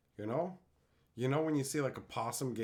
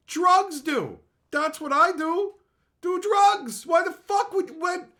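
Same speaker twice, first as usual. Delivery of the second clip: high, loud ranting shout; 10 dB louder, slower than usual. Real, not acted. Yelling at chat that drugs get you high, not spice. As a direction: yell it in disbelief.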